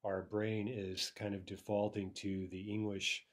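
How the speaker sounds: ordinary talk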